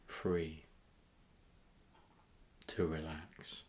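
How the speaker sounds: calm meditation voice with long pauses; guided meditation. Acted. Host guiding listeners to a tropical beach, guided meditation. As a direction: guide them gently inward, leaving space between phrases.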